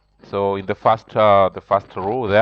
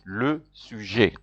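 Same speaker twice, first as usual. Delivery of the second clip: Le sujet slow and softer, luh soo-zhay; slow model word; 6 dB quieter, slower than usual. Shown as a demonstration. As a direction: repeat Le sujet slowly once more. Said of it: "Repeat after me".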